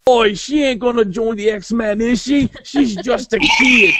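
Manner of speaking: high-pitched, hammed-up Australian accent; mock the cartoon hero with an exaggerated Aussie accent; Australian-accented Wolverine. Mocking cartoon Wolverine on Kitty joining, hammed-up Australian accent. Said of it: "he ended up with an Australian accent".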